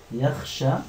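ordinary talk, nothing marked

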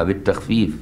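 ordinary talk

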